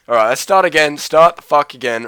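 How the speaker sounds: loud, annoyed restart; frustrated shout. Real, not acted. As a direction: snap at yourself to start over. Frustrated at game mistake.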